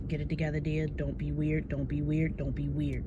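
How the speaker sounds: quiet self-talk. Retold or imitated. Telling herself to calm down.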